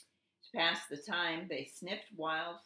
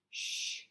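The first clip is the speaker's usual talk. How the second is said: long "shh" hush; drawn-out storybook shush. A narrator's reading. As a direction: shush a noisy companion. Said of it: "Goose hushed".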